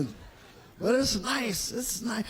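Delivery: normal talking voice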